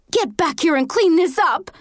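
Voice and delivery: high-pitched, furious order; angry yell. A narrator's reading. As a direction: order them back angrily. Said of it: "Missy yelled".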